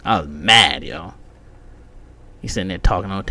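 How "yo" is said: usual speaking voice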